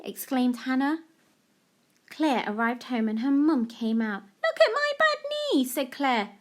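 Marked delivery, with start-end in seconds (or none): 4.4-5.7 put-on high little girl voice; high-pitched child's "look"; child showing knee. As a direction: show off the knee like an excited child. Claire's repeated line.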